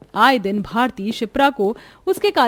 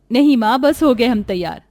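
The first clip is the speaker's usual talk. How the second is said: ordinary talk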